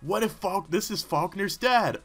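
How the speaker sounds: normal talking voice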